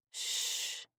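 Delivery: whispered shush; soothing hush. Acted. Granddaughter calming her grandfather, a whispered shush. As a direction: hush him gently like a nurse.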